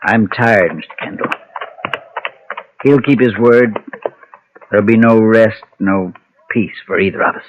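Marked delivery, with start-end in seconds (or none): 0.8-1.3 breathed out, almost voiceless; exhausted man trailing off to his protector; weary whisper. Acted. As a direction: let it fade into a tired whisper. Tired man, weary confession.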